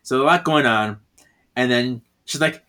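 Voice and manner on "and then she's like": normal talking voice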